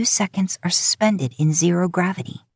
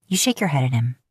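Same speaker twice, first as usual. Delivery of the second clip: ordinary delivery, nothing marked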